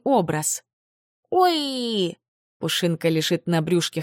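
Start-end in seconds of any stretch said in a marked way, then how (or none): 1.3-2.2 high, sweet little moan; creature moan. Acted. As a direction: coo like a tiny creature. Creature cries.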